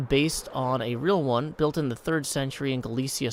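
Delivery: ordinary delivery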